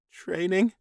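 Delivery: high squeaked question; alarmed squeak. A narrator's reading. Captive man hearing of his training, alarmed squeak. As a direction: squeak it, rising sharply. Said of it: "the human squeaked".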